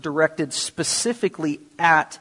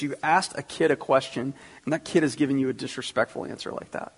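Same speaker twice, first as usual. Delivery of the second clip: ordinary talk, nothing marked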